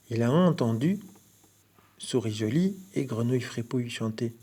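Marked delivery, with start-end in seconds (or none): none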